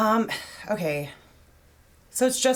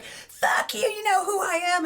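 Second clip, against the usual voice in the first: mock rude celebrity; 12 semitones higher, slower than usual. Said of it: "everyone else is like".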